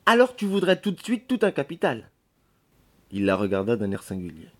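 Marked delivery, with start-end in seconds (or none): 0.0-2.1 high-pitched scoffing question; mock his big ambitions; disbelieving maid's jibe; incredulous challenge. Maid scoffs at lodger's ambition.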